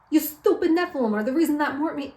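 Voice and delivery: cartoonish New Yorker accusation; bad New York accent. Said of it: "they have a bad New York accent".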